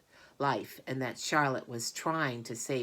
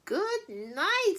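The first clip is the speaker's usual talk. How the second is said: put-on high, louder boy's scream; high mock scream; 5 dB louder than usual. A narrator's reading. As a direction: cry out at the stink. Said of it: "screamed Avery".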